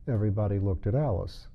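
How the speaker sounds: plain reading voice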